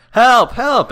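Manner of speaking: loud, high mock yell; hammed-up cartoon distress; cry for help. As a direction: imitate a kid calling for help. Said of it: "he's like".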